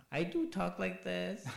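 mock her put-on way of talking; hammed-up affected drag queen voice; imitating Laganja. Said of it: "same thing with Laganja".

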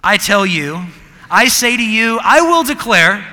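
loud mock decree, ending in a laugh; mock preacher voice for the students; mock pompous declaring. Shown as a demonstration. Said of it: "imagine your college paper was written like this".

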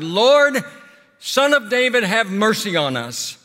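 imitating a shouted appeal to Jesus; shouted plea. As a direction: call out loudly, then draw out the plea. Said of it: "they shouted".

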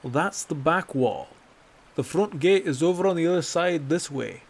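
exasperated correction. Acted. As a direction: set him straight impatiently. Bracknell correcting the hunter.

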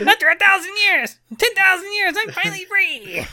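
high screeching villain cry; Rita Repulsa voice. Retold or imitated. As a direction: shriek it like a freed cartoon sorceress. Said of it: "that's a Power Rangers villain voice".

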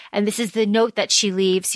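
usual speaking voice

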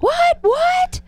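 shrill startled squeak. Retold or imitated. Mom hearing snake news, shrill startled squeak. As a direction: jump out of your skin. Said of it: "And I went".

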